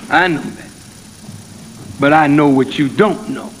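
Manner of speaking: preaching. Acted. Minister preaching on patience to the congregation, rising then slowing.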